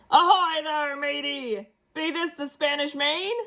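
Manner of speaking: put-on head pirate calling to the boy; pirate yell. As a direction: bellow like a pirate captain. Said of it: "and yelled".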